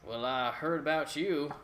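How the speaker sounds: a hearty country governor greeting guests; country accent. Acted. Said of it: "just because you're talking in a country accent".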